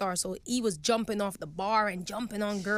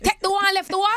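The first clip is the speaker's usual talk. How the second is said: loud high Jamaican movie quote; quoting movie lines; 10 semitones higher, 6 dB louder, faster than usual. Retold or imitated. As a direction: belt out the movie line. Reciting Shottas movie lines.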